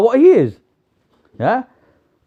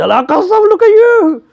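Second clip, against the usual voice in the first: high-pitched, louder, gleeful mock shriek; mock laughing disbelief; 13 semitones higher, 4 dB louder than usual. People meeting each other noseless, mock laughing disbelief. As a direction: hoot it out, acting tickled by the sight. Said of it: "everyone's laughing at each other".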